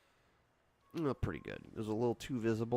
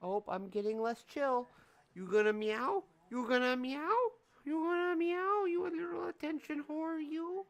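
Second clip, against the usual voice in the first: climbing ever higher, coaxing; sing-song getting higher; rising teasing pet voice. Real, not acted. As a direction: egg the cat on to meow. Teasing cat to meow.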